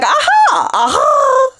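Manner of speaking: high squeaky aha of sudden realisation; mock aha moment. Viewer finally getting it, mock aha up high. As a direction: play the lightbulb moment, light and high. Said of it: "for it to be like".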